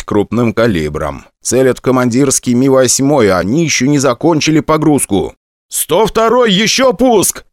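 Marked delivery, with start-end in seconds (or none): 5.7-7.4 high, urgent warning call; combat callout. Acted. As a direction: warn the wingman of another launch. Pilot warns wingman in combat.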